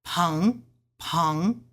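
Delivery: "Peng" rising in Mandarin second tone. Shown as a demonstration. Teaching learners the tones, "Peng" in rising second tone. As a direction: lift the pitch through "Peng". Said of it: "Second tone".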